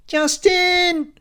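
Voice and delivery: very high, loud scream; piercing receptionist screech; Lucy. A narrator's reading. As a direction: scream it down the phone. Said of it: "she screams".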